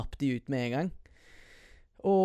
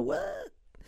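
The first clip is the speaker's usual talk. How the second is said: high squeaky mock astonishment; mock amazement; 6 dB quieter than usual. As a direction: squeal it like a wide-eyed kid. Kid amazed by Bible stories. (barnslig forbauset, mye lysere)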